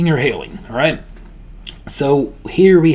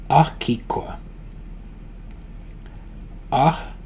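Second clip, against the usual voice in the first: Akikwa split into three, long gaps between; syllable by syllable; 3 semitones higher, 5 dB quieter than usual. Shown as a demonstration. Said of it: "just repeat that after me".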